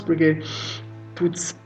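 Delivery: ordinary talk, nothing marked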